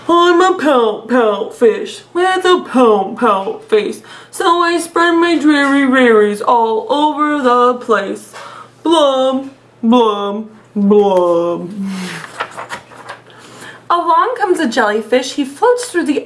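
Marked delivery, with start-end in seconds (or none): 0.1-11.7 slow, drawn-out mopey fish voice; glum pout fish voice. Acted. Teacher reads the sulky fish refrain, slow and mopey. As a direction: drag each word out like a gloomy sulking fish. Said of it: "I love doing the silly voices".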